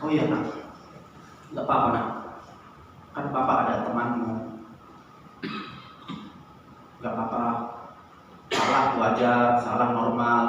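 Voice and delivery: calm him down like a loving father; gentle reassurance. Retold or imitated. Father soothes his crying son after the fight, soft and gentle.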